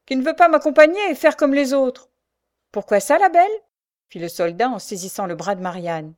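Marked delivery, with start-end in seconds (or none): 0.1-2.1 loud, high-pitched complaint; furious. A narrator's reading. Prisoner complains that Marianne won't follow, furious. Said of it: "d'un ton furieux". 2.7-3.5 high, loud demand; challenging question. A narrator's reading. Sergeant seizes Marianne's arm, loud challenging question.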